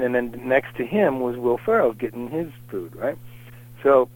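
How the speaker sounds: normal talking voice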